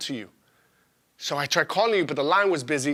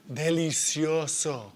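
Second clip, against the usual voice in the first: satisfied; 4 semitones lower, 5 dB quieter, much slower than usual. Acted. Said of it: "says with satisfaction".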